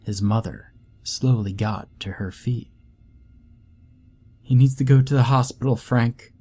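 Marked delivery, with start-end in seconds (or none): 4.4-6.3 fast and louder, pressing; urgent plea. Acted. Mother urgently tells Frank their son needs a hospital. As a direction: rush it out in alarm. Mother pleads after beating.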